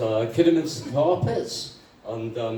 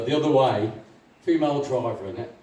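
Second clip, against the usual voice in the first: normal talking voice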